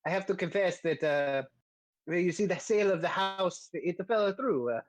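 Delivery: hesitant mock-Italian accent; sheepish Italian-accented character, hedging; Volo, bad Italian accent. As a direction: confess haltingly in a comic Italian accent. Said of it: "the bad Italian accent I was doing for Volo".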